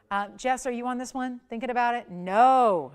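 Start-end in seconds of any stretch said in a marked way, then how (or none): 2.1-2.9 loud, emphatic NO. Retold or imitated. Host answers for a bidder thinking it over, emphatic no. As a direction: push out a firm, loud NO. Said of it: "It's another emphatic no".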